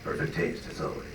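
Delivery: hushed, understated retold line; quiet imitation. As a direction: drop to a soft, voiceless murmur. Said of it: "Dietrich's little quiet little".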